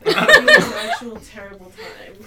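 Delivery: loud, high, laughing curse; retold playful threat, breaking into laughter; mock threat. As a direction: jokingly wish them the worst, at full volume. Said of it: "Threatening them".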